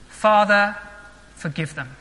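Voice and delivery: hushed, gentle prayer of mercy; tender plea. Acted. Jesus's words on the cross.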